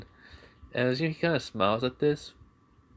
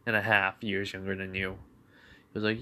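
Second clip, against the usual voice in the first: ordinary talk, nothing marked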